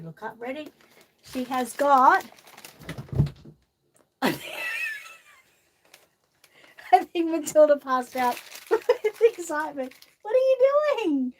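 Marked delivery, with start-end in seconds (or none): 4.4-5.1 a high shriek of a laugh bursting out. Real, not acted. Shrieking laugh as the girl tumbles over. Child falls over in excitement. 10.2-11.3 much higher, louder, caught off guard; startled cry. Real, not acted. Startled cry at the girl toppling. Child falls over suddenly.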